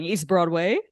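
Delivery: "East Broadway" mimicked with a rising end; mock-uncertain lift on "East Broadway"; imitated questioning tone. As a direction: tease someone's unsure "East Broadway" as a question. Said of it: "of you going".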